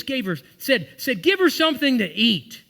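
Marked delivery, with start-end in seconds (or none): 1.2-2.6 preached command. Retold or imitated. Jesus quoted at climax.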